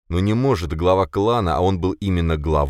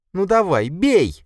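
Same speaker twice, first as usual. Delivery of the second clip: high, loud, challenging cry; heated, high-pitched defiance; defiant dare; 5 dB louder, slower than usual. A narrator's reading. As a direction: dare them to strike. Katya dares brother to hit.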